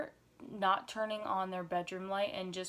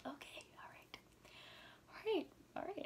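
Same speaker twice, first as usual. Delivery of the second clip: nervous whisper; 11 semitones higher, 11 dB quieter than usual. Retold or imitated. Retold nervous self-pep talk.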